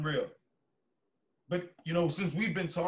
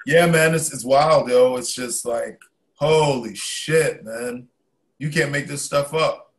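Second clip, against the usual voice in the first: much louder, wound up and incredulous; loud disbelief; 13 dB louder, slower than usual. Real, not acted. Telling his friend the news is wild, loud disbelief. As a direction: let it burst out in disbelief.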